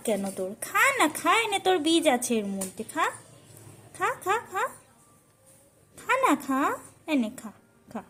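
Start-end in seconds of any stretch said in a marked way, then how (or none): none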